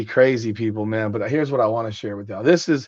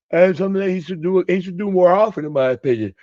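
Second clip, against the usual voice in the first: higher, louder words stretched through a yawn; 6 semitones higher, 5 dB louder than usual. Real, not acted. Host giving opinion on promo work, yawning mid-sentence. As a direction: talk right through a real yawn. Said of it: "I'm yawning".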